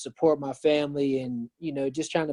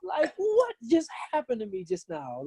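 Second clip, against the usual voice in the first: stunned disbelief; 8 semitones higher than usual. Retold or imitated. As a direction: replay the moment you couldn't believe you survived. Retelling a near-fatal crash.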